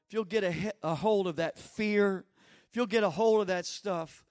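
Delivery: normal talking voice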